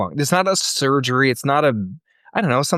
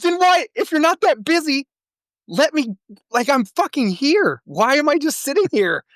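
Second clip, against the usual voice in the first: mock exasperation; 14 semitones higher than usual. Retold exasperated outburst at vet.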